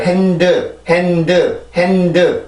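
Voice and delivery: 핸드 in two even syllables, final 드 fully voiced; Korean-style reading. Shown as a demonstration. Teacher showing how Koreans read it, two-syllable 핸드. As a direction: say 핸드 as Korean, adding the vowel after d. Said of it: "한국어로는 이 음절".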